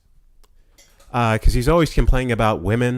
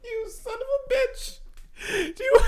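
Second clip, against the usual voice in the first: much higher, squeaking through laughter; laughing high curse; 5 dB quieter than usual. Real, not acted. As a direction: curse while cracking up.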